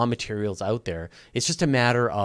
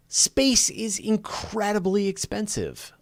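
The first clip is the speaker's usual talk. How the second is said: high, slow, mock-grand statement; dramatic pronouncement; 11 semitones higher, slower than usual. Answering viewers on YouTube-funded missions, mock-grand. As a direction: deliver it like a weighty truth, drawn out.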